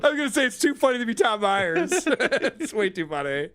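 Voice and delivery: high-pitched, talking through laughter; amused laughing. Real, not acted. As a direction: laugh your way through the guess. Amused guess at the quiz answer.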